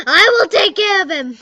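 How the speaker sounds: high, loud eager vow; excited promise. Pretend. Loud and high, playing a friend who eagerly promises. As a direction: shout the promise with joy. Acting both sides of dialogue.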